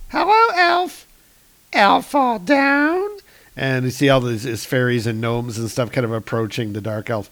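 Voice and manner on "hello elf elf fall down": very high squeaky little fairy. Acted. Fairy to fallen dark elf, very high fairy voice. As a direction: pipe up in a tiny, high creature voice. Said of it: "we hear a voice from off panel".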